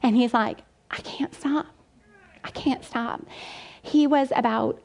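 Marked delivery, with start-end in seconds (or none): 0.9-3.2 hushed, pained retold plea; much softer, a child's helpless confession; imitating sobbing son. Son breaking down to his mom, softly imitated sob. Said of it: "he just started sobbing".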